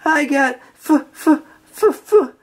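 high, choked stutter; can't bring himself to say it; stammering distress. A narrator's reading. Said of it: "SpongeBob cried".

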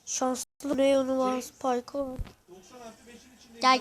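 usual speaking voice

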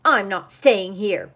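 loud, upset wail. A narrator's reading. Duck quitting the cabin after squabble, wailed. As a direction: wail it out in protest. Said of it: "wailed the duck".

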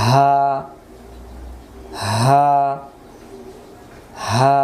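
হা held long and open from the throat; drawn-out ha. Shown as a demonstration. Teacher demonstrating the ha for students, open throat. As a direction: let each হা ring out, pausing between. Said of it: "গলা ছেড়ে দিয়ে".

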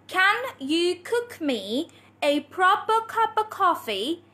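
very slow, word-by-word modelling; tongue-twister demo. Shown as a demonstration. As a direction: say it slowly and deliberately for learners to copy. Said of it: "This is your next tongue twister".

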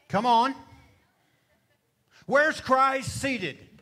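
ordinary talk

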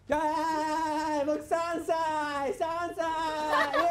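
put-on high, childlike kid voice; three-year-old voice. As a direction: talk like a toddler, slow at first. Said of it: "三歳児になります".

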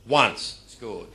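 usual speaking voice

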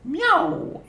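very high squeaky kitten meow; cat meow voice. Pretend. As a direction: mew it like a tiny cat. Presenting character plushies. (maullido agudo de gatito)

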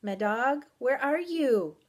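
calling out. A narrator's reading. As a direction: call out to someone lost in mist. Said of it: "My voice rang in the mist".

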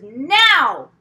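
loud high call up the stairs. A narrator's reading. Mother calling daughter up the stairs, loud. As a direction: shout her name from below.

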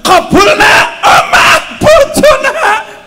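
high, loud, laughing mother blessing her son; joyful mother shout. Acted. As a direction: cry out the blessing with joy. (ibu berseru gembira)

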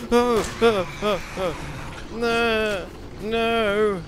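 high, loud terrified shrieks; panicked screaming. Real, not acted. Chased by monsters in horror game.

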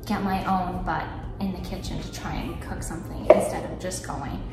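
ordinary delivery

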